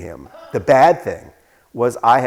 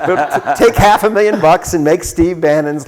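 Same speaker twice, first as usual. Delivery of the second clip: excited pitch; 7 semitones higher, 10 dB louder than usual. Real, not acted. Eager pitch over audience laughter.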